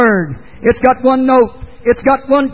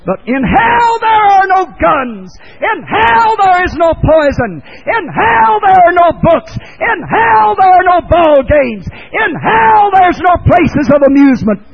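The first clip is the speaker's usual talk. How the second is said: rising shouted hell refrain, each line hammered; preaching at full force; 7 semitones higher than usual. Acted. Preacher warning the congregation, shouting hell refrain. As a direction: thunder out what hell lacks.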